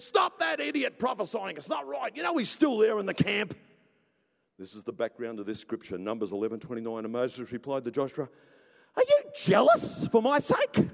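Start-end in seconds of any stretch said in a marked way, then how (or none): 0.0-3.5 high-pitched tattling complaint; indignant Joshua. Acted. Joshua complains to Moses about the man prophesying, indignant. 9.0-10.9 high, loud, slow rising question; incredulous Moses. Acted. Moses replies to Joshua, loudly incredulous.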